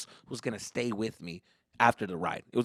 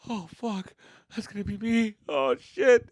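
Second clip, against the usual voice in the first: higher, small scared voice; retold dread; 8 semitones higher, slower than usual. Kid watching criers in line, acted high dread. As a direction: imitate a kid dreading his turn.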